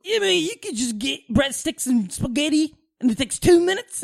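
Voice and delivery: high, amazed Australian impression; hammed-up, badly faked Australian wonder; attempted Australian accent. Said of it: "who was from Australia".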